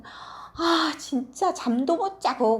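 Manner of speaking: high, loud grumble; sulky daughter complains loudly; grumbling and whining. Acted. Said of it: "막 투덜투덜, 투덜거리면서".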